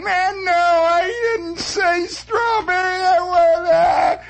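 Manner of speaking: very high, drawn-out mock wail; screaming kid. Kid refuses his ice cream cone, screaming wail. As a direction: whine and screech like a bratty child. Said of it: "just constant screaming".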